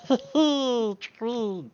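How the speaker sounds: high mad pixie giggle; maniacal laugh. Acted. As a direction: cackle like a crazed sprite.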